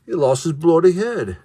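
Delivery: bad English accent. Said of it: "Like the worst English accent ever".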